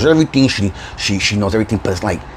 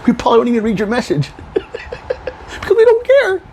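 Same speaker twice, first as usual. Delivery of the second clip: laughing dismissal; 12 semitones higher than usual. Real, not acted. Dismissing the critics with a high laugh. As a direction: laugh off their messages.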